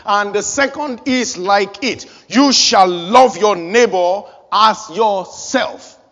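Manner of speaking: slow, ringing scripture declaration; slow emphatic proclamation. Acted. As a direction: proclaim it slowly like a verdict.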